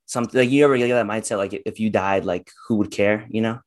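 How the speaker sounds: ordinary talk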